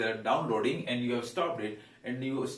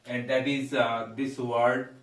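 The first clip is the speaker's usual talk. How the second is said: ordinary talk, nothing marked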